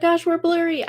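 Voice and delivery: ordinary talk, nothing marked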